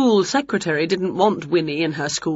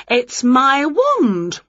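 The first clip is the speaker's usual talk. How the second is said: slow witch voice; 7 semitones higher, 3 dB louder, much slower than usual. Acted. Winnie answers the game.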